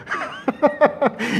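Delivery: amused little laugh; soft high laugh. Real, not acted. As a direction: laugh softly at the joke. Laughs at his own joke.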